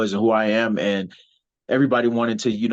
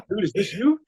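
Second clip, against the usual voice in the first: higher, amazed mock question; friends amazed; 9 semitones higher than usual. A wrestler voicing friends who saw him on Yahoo, amazed. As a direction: voice friends' disbelief. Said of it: "everybody hits me up".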